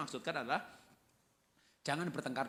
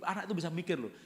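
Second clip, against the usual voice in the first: normal talking voice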